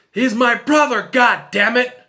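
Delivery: high, loud yell, half-acted from memory; retold anguished shout. Firefighter shouting for his trapped brother, mock yell. Dying brother's desperate outburst.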